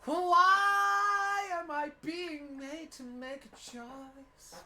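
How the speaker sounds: high, very slow drawn-out whine; whiny protest. Real, not acted. Forced to pick a party, in a high drawn-out whine. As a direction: stretch it out in disbelief. Outraged at being made to pick.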